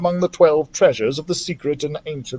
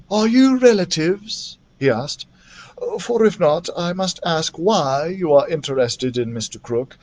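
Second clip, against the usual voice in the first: a hint of a suspicious churchyard gardener, measured; wary old gardener; 4 semitones higher than usual. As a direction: gardener gently warning the children, lightly voiced. Gardener questions the children.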